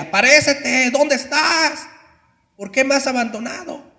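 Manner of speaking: high, loud cry to an absent God; desperate shouting. Retold or imitated. Dying young man shouting at God, desperate loud cry. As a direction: shout it up at heaven, pleading. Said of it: "Y gritaba y gritaba".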